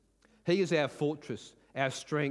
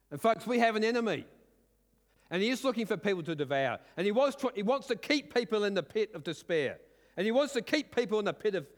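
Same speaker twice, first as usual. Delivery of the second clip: preaching at full force; 6 semitones higher than usual. Acted. Pastor rallying the congregation, fervent preaching. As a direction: drive the victory home to the church.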